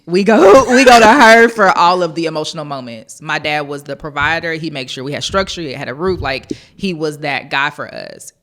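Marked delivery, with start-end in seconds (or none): 0.0-3.2 laughing loudly through the line; a big laugh running through every word. Real, not acted. Laughing to Miss Wendy about going to mom for tears, loud laugh. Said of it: "laughing retelling to Miss Wendy".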